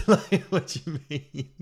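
slow, laughing high question. Retold or imitated. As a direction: ask it through laughter.

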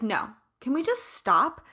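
ordinary talk

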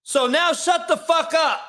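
hammed-up shouting tirade; mock ESPN rant shout. Shown as a demonstration. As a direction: blow up like a pundit losing it on air. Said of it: "I can roll my hands like this".

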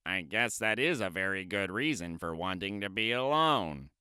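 relieved Toad. Acted. Toad understanding Frog, low relieved. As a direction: accept it gently as Toad.

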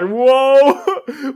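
high, loud drawn-out whoa; exaggerated amazed reaction; big soaring "whoa" of disbelief; astonished. Mock amazed reaction to casting.

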